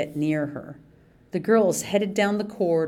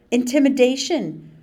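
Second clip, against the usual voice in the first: high, loud shrill accusation; 7 semitones higher, 6 dB louder than usual. Acted. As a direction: cry it out high as if wronged. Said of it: "Mandy shrilled".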